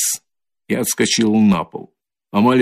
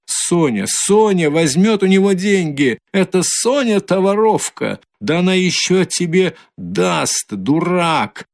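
high, angry tirade; furious woman; 7 semitones higher than usual. A narrator's reading. Katerina furiously abusing Luzhin for accusing Sonya. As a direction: hurl the insults at him.

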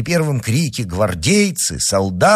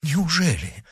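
high-pitched, softer gasp of disbelief; astonished cry; 8 semitones higher, 4 dB quieter than usual. A narrator's reading. Broussel hearing the duke announced, astonished exclamation. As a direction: exclaim in amazement without shouting. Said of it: "вскричал Бруссель".

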